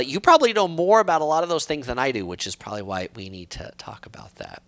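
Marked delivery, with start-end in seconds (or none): 2.3-4.6 a soft whispered afterthought; whispered aside. Real, not acted. Teasing a knowledgeable viewer, whispered. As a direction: let it fall away into a whisper.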